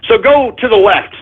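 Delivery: usual speaking voice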